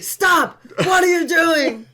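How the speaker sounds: high, loud imitation of a panicked passenger yell; mock scream. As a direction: shout it up high like he did in the car. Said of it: "he's screaming".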